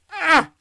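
short high scream; sudden yelp while blowing the nose; quick involuntary-sounding shriek. Real, not acted. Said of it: "I just scream".

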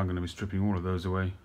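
normal talking voice

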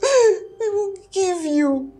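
tearful begging. Acted. Mother begging in tears.

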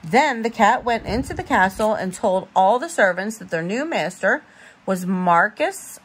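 usual speaking voice

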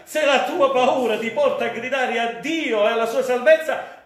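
usual speaking voice

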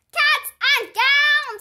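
high, loud, slow holler of "Cats and gowns!". Acted. Junie hollering to the class, "Cats" loud, high and drawn out. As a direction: belt out "Cats and gowns" with "Cats" stretched. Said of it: "I hollered".

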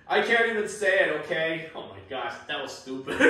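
usual speaking voice

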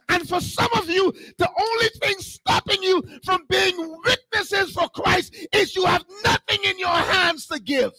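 high-pitched, impassioned sermon cry; full-force preaching. Real, not acted. Preacher urging church to bless somebody else, impassioned preaching. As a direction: preach it out with fervour.

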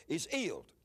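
"healed" said as "eeled", H left off; dropped H. Retold or imitated. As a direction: say HEALED with no H, English dialect style. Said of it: "pronounce H's".

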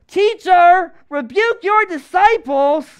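high, loud mock complaint; hammed-up scolding demand, drawn out; grumbling Pharisees. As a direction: call it out as indignant grumblers. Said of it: "here are these grumblers".

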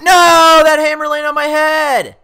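high, very loud gamer rage; outraged yell. Real, not acted. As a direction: scream in protest at an unfair hit. Outraged at losing in game.

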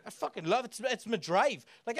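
ordinary delivery

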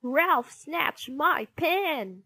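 high, drawn-out complaint; indignant tattling. A narrator's reading. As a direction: tell on a classmate loudly. Pupil tells on classmate.

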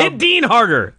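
high, egging-on ringside yell; mock fan cheering. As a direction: playfully urge the wrestler on like a fan. Cheering on the retold fight.

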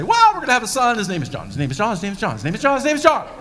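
bursting to tell his wife; hammed-up giddy joy; joyful exclamation. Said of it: "run over to Elizabeth and say".

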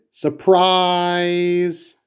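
high, bright party shout of surprise. Acted. Guests jumping out at Big Bird, shouting surprise. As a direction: yell it as the lights flick on. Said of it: "and everyone shouted".

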